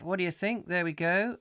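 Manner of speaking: ordinary delivery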